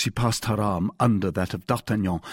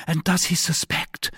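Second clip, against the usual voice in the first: higher-pitched, mildly embarrassed heroine; 8 semitones higher than usual. A narrator's reading. Wife asks whether her husband suspects the cause, mildly embarrassed. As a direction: ask with a touch of embarrassment. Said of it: "with some embarrassment".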